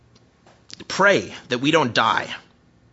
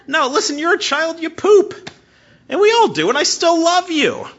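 high, loud, impassioned preaching; fervent pastor at full force; 13 semitones higher, 9 dB louder than usual. Acted. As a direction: preach it out to the whole room.

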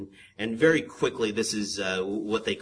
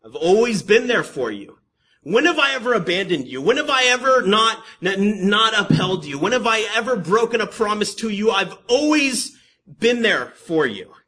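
raised, high preacher's voice as God; God's impassioned protest; 10 semitones higher, 8 dB louder than usual. Acted. God challenges his wavering people, impassioned protest. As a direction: plead loudly as a wronged God. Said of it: "God says".

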